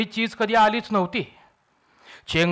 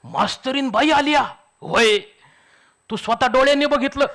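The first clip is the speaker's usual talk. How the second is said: higher, astonished echo of मास्तरीन बाई आली या; 6 semitones higher than usual. Acted. Villagers stunned to hear a lady teacher arrived, higher astonished. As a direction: gasp out the news in disbelief. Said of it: "सगळ्यांची मुद्रा एकदम आश्चर्यचकित".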